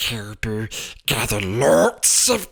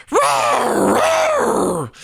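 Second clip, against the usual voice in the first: owl calls; 4 dB louder than usual. A narrator's reading. Owl hooting while playing.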